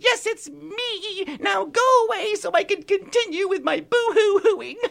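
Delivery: very high, slow weepy little voice; tiny pixie voice. Acted. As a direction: whimper like a tiny crying pixie. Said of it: "a little voice said".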